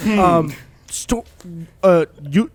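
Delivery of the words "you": usual speaking voice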